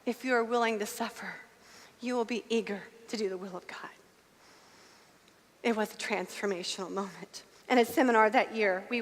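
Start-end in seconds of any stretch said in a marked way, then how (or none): 0.0-3.9 hushed, solemn divine whisper; quiet, measured, weighty words; voice of God. Retold or imitated. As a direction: speak softly as God answering. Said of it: "clearly heard God's voice say".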